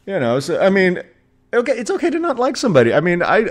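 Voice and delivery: normal talking voice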